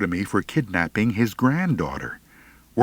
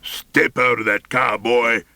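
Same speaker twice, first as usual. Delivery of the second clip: ordering; 2 semitones higher, 3 dB louder than usual. Acted. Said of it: "Scritch ordered".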